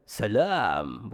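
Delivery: slow, low "salam" swallowed into the throat. Shown as a demonstration. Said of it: "Suara tenggorokan".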